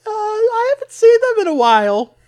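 put-on very high, loud boy voice; high kid voice.

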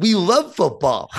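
can't believe his son would quit; laughing disbelief. Retold or imitated. Dad to son who quits football, laughing and disbelieving. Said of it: "I said".

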